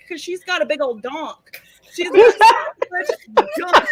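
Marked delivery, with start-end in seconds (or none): 1.9-3.9 laughing. Real, not acted. Teasing joke about Mindy.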